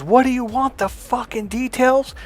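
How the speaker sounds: high-pitched mock exasperation; exasperated coach, imitated. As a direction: throw it out high, like a fed-up man. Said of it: "he looks at it and goes".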